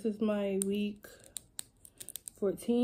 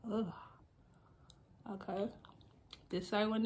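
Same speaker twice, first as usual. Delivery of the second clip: pushing aligner tray in; speaking while fitting teeth aligners; fingers in mouth; 3 dB quieter, faster than usual. Real, not acted. Seen 2: Handles aligner tray, then fingers press it into mouth from 4.6s.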